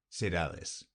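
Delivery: ordinary delivery, nothing marked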